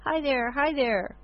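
put-on high, gleeful baby voice; baby squeal. A narrator's reading. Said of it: "squeal with glee".

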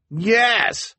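high, loud shout of assent; calling from crowd. A narrator's reading. Townsperson agrees to the proposed time, eager call. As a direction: pipe up eagerly.

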